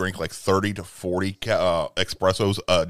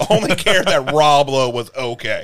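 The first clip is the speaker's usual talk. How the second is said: ordinary talk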